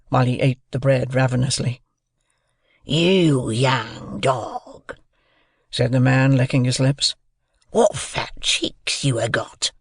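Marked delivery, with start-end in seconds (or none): 2.8-5.0 very slow, drawn-out rough man's voice; menacing convict. A narrator's reading. Convict eyes the boy while licking his lips, menacing. 7.7-9.7 very slow, drawn-out rough man's voice; menacing convict. A narrator's reading. Convict eyes the boy while licking his lips, menacing.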